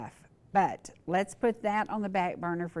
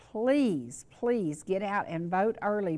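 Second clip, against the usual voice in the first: usual speaking voice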